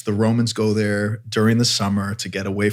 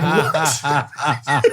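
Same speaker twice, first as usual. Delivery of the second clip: laughing what; 4 semitones higher than usual. Retold or imitated. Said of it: "I'm like".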